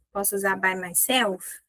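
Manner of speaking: usual speaking voice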